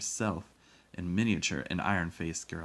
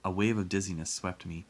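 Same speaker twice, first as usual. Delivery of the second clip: ordinary delivery, nothing marked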